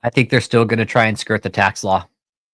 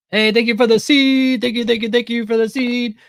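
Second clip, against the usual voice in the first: high, put-on preacher voice thanking for the seed; mock televangelist; 12 semitones higher than usual. Parodying the bishop asking for money, high hammed preacher voice. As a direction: ham up a greedy TV preacher, much higher.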